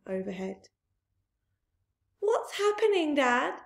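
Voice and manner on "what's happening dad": put-on high, frightened children's cry. Small foxes crying to their father in fear, high cry. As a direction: cry out to Dad in alarm. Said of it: "cried the small foxes".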